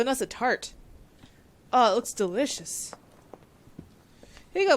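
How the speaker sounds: normal talking voice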